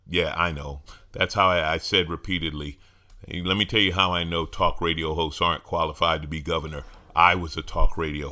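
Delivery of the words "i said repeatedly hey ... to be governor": ordinary talk, nothing marked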